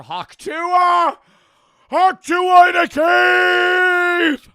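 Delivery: yelling it at the top of his voice, high and loud; excited shout. Real, not acted. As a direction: belt it out like a meme chant, slow and drawn out. Shouting meme on finding notebook.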